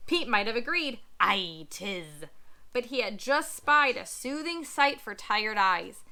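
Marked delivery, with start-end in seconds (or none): 1.2-2.2 low pirate grunt. Acted.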